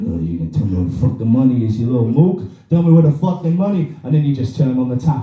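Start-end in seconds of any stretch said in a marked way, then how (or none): 0.1-3.9 mock mobster. Mobster extracting information from a bath toy, mock menace. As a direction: pretend gangster threat, starting low and building. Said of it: "trying to extract some information from this little prick".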